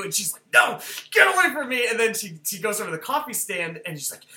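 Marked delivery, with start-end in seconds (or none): 0.5-1.9 loud, high acted outburst; hammed-up, frantic push-away; panicked rebuff. Acted character rebuffing helpers.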